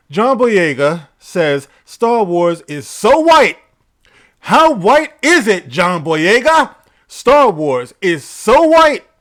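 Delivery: loud, slow, punched-up mockery; mocking headline read. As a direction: boom out the headline like a hammed-up joke setup. Mock-announcing a headline as setup joke.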